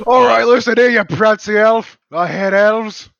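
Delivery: a gruff Australian accent put on to mock an elf. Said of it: "Australian accent?".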